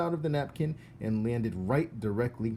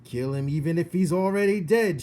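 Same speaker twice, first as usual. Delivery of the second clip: higher, loud shouted order; 7 semitones higher, 9 dB louder than usual. Acted. Cook shouting her philosophy at Mig, loud. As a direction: yell it across the kitchen. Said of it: "shouted Cook".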